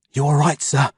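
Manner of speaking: ordinary delivery, nothing marked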